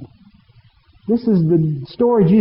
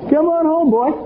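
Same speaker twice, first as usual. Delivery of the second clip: mocking call from the porch, higher; smug gloating father; 6 semitones higher than usual. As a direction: savour the son's humiliation. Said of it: "stood on the porch going".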